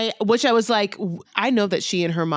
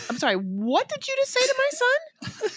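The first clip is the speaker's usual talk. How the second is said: outraged Jasmine. Retold or imitated. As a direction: shriek it out in disbelief. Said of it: "fucking Jasmine's like".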